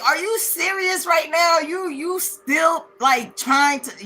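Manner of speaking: high-pitched retold disbelief; incredulous, stretching out the words. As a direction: act out stunned disbelief, voice way up. Retold disbelief at injured fiance's threat.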